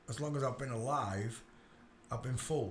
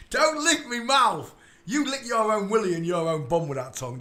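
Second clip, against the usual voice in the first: loud scolding; 7 semitones higher, 11 dB louder than usual. Real, not acted. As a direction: stop the dog loudly. Dog licks his mouth.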